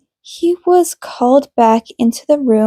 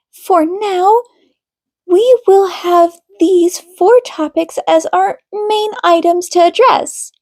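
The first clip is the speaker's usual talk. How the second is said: higher, measured counselor voice; calm therapist; 6 semitones higher than usual. A narrator's reading. Counselor Minami addressing the couple in session, composed and higher. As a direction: speak like a gentle, professional marriage counselor.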